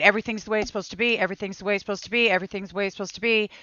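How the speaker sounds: very fast looping mantra; rapid mantra. Retold or imitated. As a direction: spin it out like a thought racing through your head. Mantra floods back into her mind.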